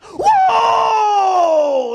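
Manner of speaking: long, high, booming whoop; drawn-out joyful shout, falling at the end; shout out worship. Acted. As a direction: let out a huge amazed holler. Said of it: "It's like, shout out worship".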